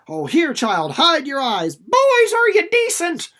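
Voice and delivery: put-on loud, high call, rising to a shout on boys; mother calling to her sons, loud and high; crying out. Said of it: "she cries out".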